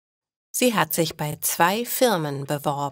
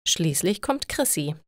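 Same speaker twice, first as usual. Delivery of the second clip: usual speaking voice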